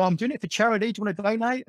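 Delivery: light cheeky joke, voice lifted; cheeky joking pitch for charity; cheeky fundraiser ask. Retold or imitated. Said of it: "I was just joking".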